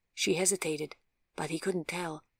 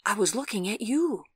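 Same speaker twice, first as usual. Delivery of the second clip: higher, louder, weight landing on "you"; emphatic; 5 semitones higher, 5 dB louder than usual. A narrator's reading. Cyclist blurting out his answer to the girl, emphatic "you". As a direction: drive the line up to "you". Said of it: "he said emphatically".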